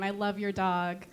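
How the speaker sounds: usual speaking voice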